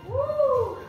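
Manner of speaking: high loud cheering woo; excited whoop. Real, not acted. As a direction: let out a big cheer. Cheering during plank roll.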